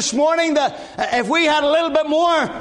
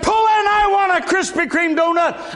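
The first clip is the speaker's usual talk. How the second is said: excited demand; 7 semitones higher than usual. Retold or imitated. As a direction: blurt it out like a sudden craving. Retold craving, urging wife.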